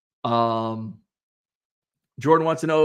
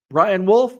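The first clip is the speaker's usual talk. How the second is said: ordinary talk, nothing marked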